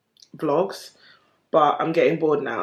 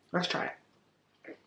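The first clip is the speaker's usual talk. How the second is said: whisper; 10 dB quieter, much slower than usual. Real, not acted. About to taste the burger.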